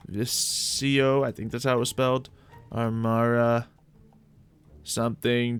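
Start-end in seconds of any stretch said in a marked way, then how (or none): none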